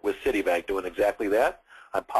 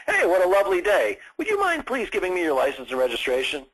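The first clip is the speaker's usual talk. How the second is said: mock-pleasant officer greeting a driver; overly friendly police officer; 7 semitones higher than usual. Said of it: "typically don't come by and say".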